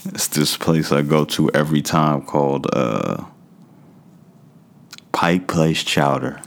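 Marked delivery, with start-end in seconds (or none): none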